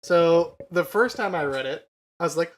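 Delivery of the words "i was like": ordinary talk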